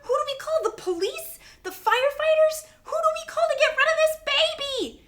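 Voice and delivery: high, loud panicked questions; freaking out. Acted. Teacher to Kevin with kids screaming, freaking out. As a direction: fire off frantic questions, building to a shriek. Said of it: "I was freaking out too".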